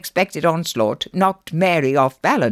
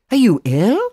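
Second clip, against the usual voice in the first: raised, louder worried question; concerned, pitched-up check; anxious; 7 semitones higher, 7 dB louder than usual. A narrator's reading. Jack anxiously checks on the child. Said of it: "he asked anxiously".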